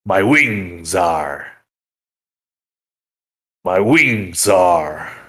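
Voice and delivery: a lightly voiced dragon gloating over its hoard; mildly menacing dragon taunting the arriving party; boastful dragon. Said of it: "and he goes".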